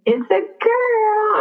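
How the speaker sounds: slow, high, choked-up words through tears; tearful. Real, not acted. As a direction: let the news break you, slowly. Phone call reveals baby is a girl.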